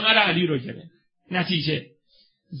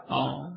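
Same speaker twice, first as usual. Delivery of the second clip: grief sigh; 10 semitones lower, 9 dB quieter, slower than usual. Acted. Mourner's sigh in the tale.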